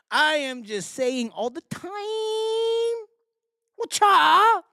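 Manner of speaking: mock high voice. Mock voice at chat.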